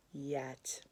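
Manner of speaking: a soft whispered final word; hushed closing promise. Acted. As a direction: let it fall to a whisper. God's line trails to whisper.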